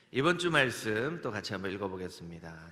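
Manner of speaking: usual speaking voice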